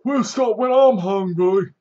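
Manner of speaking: high, loud, slow mock barked order; gruff lieutenant quote. Lieutenant on the bus mocked, high loud slow order. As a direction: bark it like a pompous officer. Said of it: "these are quotes now I'm saying".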